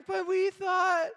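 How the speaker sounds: lightly voiced disappointed children; mock whine of let-down kids; whiny kids. Kids protest about broken promise.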